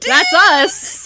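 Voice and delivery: high, loud thrilled yell; excited shout. Real, not acted. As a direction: cheer with disbelief. Thrilled at being named.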